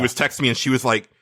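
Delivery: ordinary delivery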